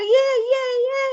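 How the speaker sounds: high, loud excited cheer; mock kids cheering. Teacher imitating kids cheering in class, high excited cheer.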